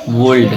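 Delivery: वर्ल्ड with the L sounded, deliberately wrong; वर्ल्ड read letter by letter with the L. Shown as a demonstration. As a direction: say वर्ल्ड with a clear L, the spelling way. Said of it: "गलत इसलिए पढ़ा रहा हूँ".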